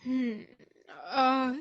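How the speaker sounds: ordinary talk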